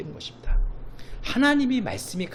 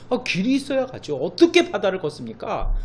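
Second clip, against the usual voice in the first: frustrated complaint; 5 semitones higher, 5 dB louder than usual. Retold or imitated. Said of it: "우리 입장에서 답답하죠".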